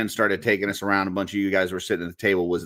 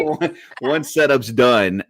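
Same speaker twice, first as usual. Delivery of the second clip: laughing; 5 semitones higher, 4 dB louder, much slower than usual. Real, not acted. Resumes question while laughing.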